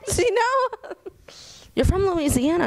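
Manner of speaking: high-pitched laughing incredulity; amused disbelief. Real, not acted. Adult asking child's mom about Mardi Gras, laughingly incredulous.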